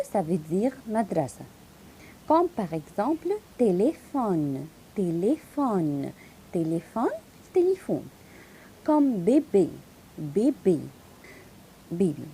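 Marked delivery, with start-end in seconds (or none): 2.3-8.1 téléphone said slowly, each é closed. Shown as a demonstration. Teacher drilling téléphone slowly, closed é. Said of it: "donne-moi le son fermé". 8.8-12.3 bébé with two closed é vowels; closed é sound. Shown as a demonstration. Teacher repeating bébé with closed é. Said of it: "donne-moi le son fermé".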